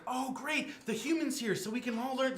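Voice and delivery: put-on high, excited girl voice. Girl slips out of character over the human, excited. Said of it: "She gets so excited".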